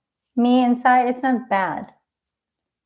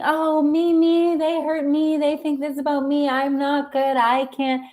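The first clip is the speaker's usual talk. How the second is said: mock screaming small me; 7 semitones higher than usual. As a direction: mimic a needy inner self, pitched up.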